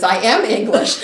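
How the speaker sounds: loud, laughing retold reply; laughing while quoting. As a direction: quote said through a laugh. Quotes British woman, laughing.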